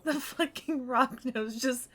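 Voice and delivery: high, helpless giggling through the words; sobbing with laughter. Real, not acted. Streamer cracking up over this picture, sobbing with laughter. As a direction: crack up while trying to talk. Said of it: "I'm sobbing".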